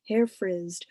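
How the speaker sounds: usual speaking voice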